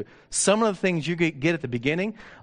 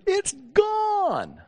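amazed; 3 dB louder than usual. Retold or imitated. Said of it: "And she says".

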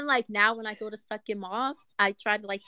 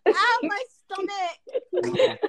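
high, drawn-out laughing moan; real breathless laughing fit; helpless laughter; 10 semitones higher, much slower than usual. Real, not acted. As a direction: squeal it slowly through aching laughter. Helpless laughter hurts stomach.

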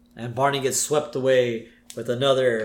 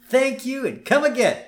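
high, cheery mock clerk line; 10 semitones higher than usual. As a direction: sing out a shop farewell. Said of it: "practicing his new job".

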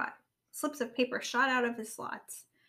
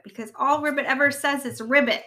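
louder, higher-energy grumbling; barked complaint; 3 semitones higher, 8 dB louder than usual. A narrator's reading. Rabbit complains to Robot about Ribbit, barked. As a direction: bark out the complaint. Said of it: "barked Rabbit".

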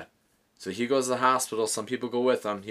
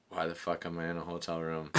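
groggy confusion; 6 semitones lower, 10 dB quieter than usual. Retold or imitated. Drunk man wakes up confused.

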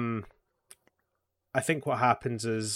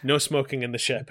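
normal talking voice

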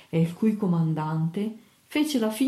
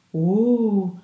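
a loud, drawn-out "oh" of surprise; surprised exclamation; 6 dB louder than usual. A narrator's reading. Sailors react to the mastro's unexpected subject, surprised and loud. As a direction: gasp it out as an astonished crowd. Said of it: "di sorpresa uscì da tutte le bocche".

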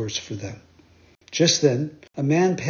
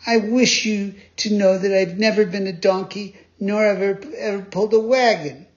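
high, louder indignant protest; indignant boy voice, higher and louder; offended; 6 semitones higher, 4 dB louder than usual. A narrator's reading. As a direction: huff with wounded pride. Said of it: "very much offended".

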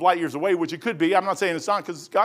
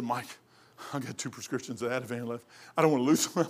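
lightly voiced mother, much softer; soft mom voice; 7 semitones lower, 8 dB quieter than usual. Mother quietly begging her son over her pills, softer. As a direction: murmur it like a pleading old mom.

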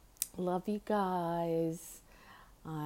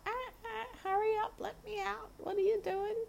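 very high squawky bird voice; imitating the parrot. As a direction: screech like an impatient parrot. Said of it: "I don't get that, from her".

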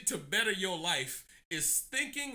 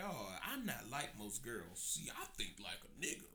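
mocking girl voice; 10 semitones lower, 14 dB quieter than usual. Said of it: "bitches that be like".

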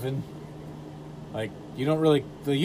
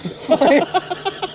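high shrieking laugh; 5 dB louder than usual. Real, not acted. Loud burst of laughter.

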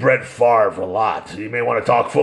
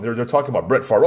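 ordinary talk, nothing marked

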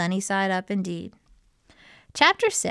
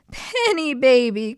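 very high, louder outcry; joyful cry; 6 semitones higher, 5 dB louder than usual. A narrator's reading. Henrietta hugging her rescued egg, high acted cry. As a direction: cry out like a reunited mother hen. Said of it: "cried Henrietta".

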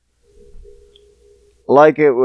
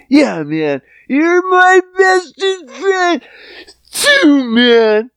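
put-on much higher, drawn-out drunk buddy; high drunk friend voice; 9 semitones higher, slower than usual.

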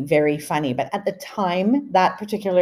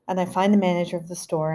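ordinary delivery

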